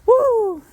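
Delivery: loud high whoop of triumph; triumphant whoop. Real, not acted. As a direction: whoop at making it across. Made it across the water.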